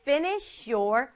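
"finish your" slowly joined as one unit, fini-shyour; linked words. Shown as a demonstration. Teacher showing easier pronunciation, finish your linked together. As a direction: glide from the sh of finish straight into your. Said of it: "your를 조금 붙여서".

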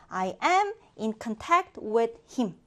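I am in contact with him read very slowly, word by word; very slow model sentence. Shown as a demonstration. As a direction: dictate it slowly to the class. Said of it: "teacher models sentence".

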